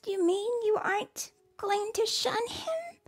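put-on high, young girl's hopeful question; a girl daring to hope; high child's voice, asking softly; hopeful little girl. A narrator's reading. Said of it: "Ruth asked".

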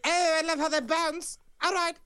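high, giddy leering voice. Acted. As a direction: gloat like a cartoon pervert. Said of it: "It's like".

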